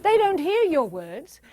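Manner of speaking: ordinary talk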